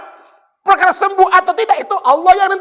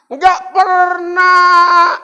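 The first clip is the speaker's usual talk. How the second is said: slow preacher shout; 3 semitones higher, much slower than usual. Real, not acted. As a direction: call out Nggak pernah, stretching pernah. Repeat, shouted. (teriakkan pernah dengan lambat)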